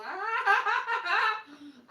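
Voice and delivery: a sudden, high, loud laugh; real laughter. Real, not acted. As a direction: burst out laughing. Laughing at the joke.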